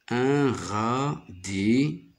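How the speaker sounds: "Un radis" drawn out as un... ra... di; very slow, radis split into ra - di; syllable by syllable. Shown as a demonstration. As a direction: sound out radis with each syllable held. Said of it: "J'y découpe les mots comme ça".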